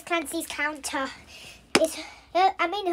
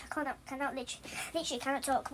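hushed, trying not to talk; quiet; 13 dB quieter, much faster than usual. Real, not acted. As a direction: keep it very quiet. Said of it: "I can't talk too much".

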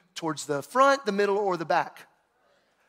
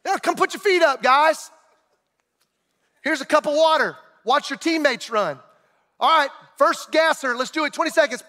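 higher, syrupy sarcastic coach voice; mock hospitable coach; 6 semitones higher, 3 dB louder than usual. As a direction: play the coach as a mock host offering water. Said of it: "That's what it felt like".

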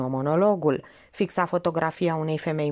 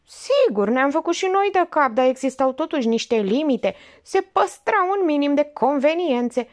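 higher scandalised grumble; indignant old aunt; 6 semitones higher, 3 dB louder than usual. Acted. Old aunt scandalised by her niece, grumbling. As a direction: huff about modern manners.

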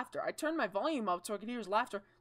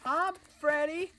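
high greeting voice; 7 semitones higher than usual. Pretend. Greeting the animatronic in a voice.

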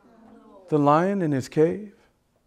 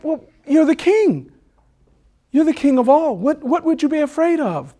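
very high, raised monkey voice; incredulous monkey; 13 semitones higher, 5 dB louder than usual. Acted. Monkey reassures the cowardly king, high incredulous protest. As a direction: protest high and loud in disbelief.

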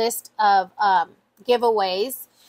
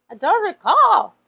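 louder, higher, slow "I don't recall"; higher, louder exclaimed line; emphatic exclaim; 5 semitones higher, 7 dB louder than usual. Real, not acted. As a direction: throw it out to the viewers with emphasis.

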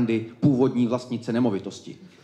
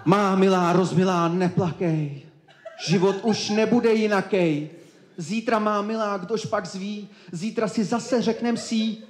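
high, slow, drawn-out verse; wooing lover; 8 semitones higher, slower than usual. Acted. Karel kneels before Hernanda, high slow theatrical wooing. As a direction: recite love lines high and lingering.

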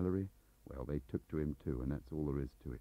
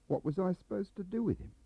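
exasperated; 13 semitones higher than usual. Acted. Meadows protests his predicament.

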